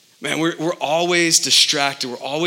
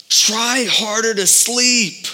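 mock advice; 6 semitones higher, much slower than usual. Mocking failed advice.

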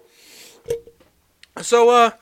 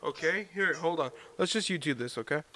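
ordinary talk, nothing marked

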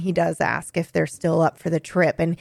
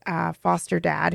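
normal talking voice

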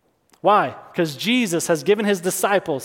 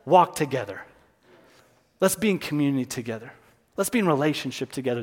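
gentle invitation; 6 semitones lower, 4 dB quieter, faster than usual. Acted. God voiced inviting closeness.

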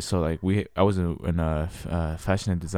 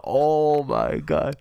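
slow, drawn-out, higher shocked voice; stunned; 5 semitones higher, much slower than usual. Real, not acted. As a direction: let the horror sink in slowly. Shocked at the hit.

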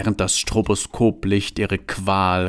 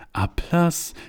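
startled call; 8 semitones higher than usual. A narrator's reading. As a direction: call it out as a high, puzzled question. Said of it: "rief Johanna". (hoch und fragend gerufen)

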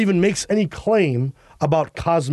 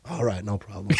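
low, soft resigned clerk voice; hotel clerk giving in; 6 semitones lower, 7 dB quieter than usual. Acted. Worn-down clerk granting late checkout, low resigned voice. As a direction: drop low and concede reluctantly.

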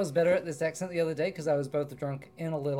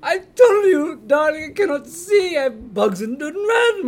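higher, louder theatrical; ghoulish nanny voice; 10 semitones higher, 12 dB louder than usual. Acted. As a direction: wail like a dead nanny. Undead nanny, high loud.

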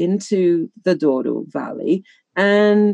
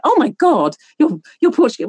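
high amazed exclamation; imitating astonished waiters; mock amazement, pitched up; surprised locals; 9 semitones higher than usual. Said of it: "They're like".

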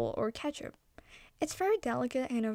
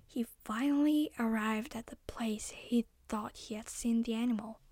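whisper; 4 dB quieter than usual. A narrator's reading. Suspenseful whispered narration.

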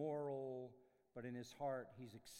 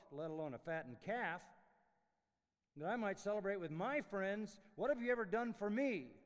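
louder aggrieved protest, building higher; resentful complaint; 4 semitones higher, 8 dB louder than usual. Acted. Older son protesting to his father, building resentment. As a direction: let the grievance swell to the end.

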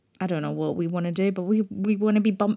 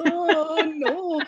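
higher, laughing mock horror; laughing dread; 7 semitones higher than usual. Host on meeting people again, laughing mock horror. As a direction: giggle through it in mock panic. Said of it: "I'm like".

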